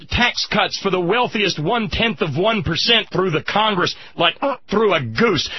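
ordinary delivery

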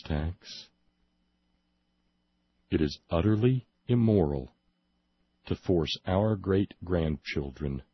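low, deliberate reading with grave pauses; straight newsreader. Shown as a demonstration. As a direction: deliver it straight up, phrase by phrase. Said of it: "as straight up as I can do".

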